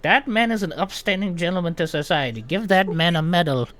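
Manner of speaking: high, loud grand praise; old gnome proclaiming. Acted. Old gnome praising Moose to the healer, high loud proclamation. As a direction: announce it like a doddering town crier. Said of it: "be like".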